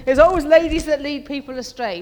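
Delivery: loud, mocking overstatement of what some people say; mock sweeping claim. Preacher mocking people who say women lead others astray, loud mock claim. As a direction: boom it out as if it is obvious, then undercut it.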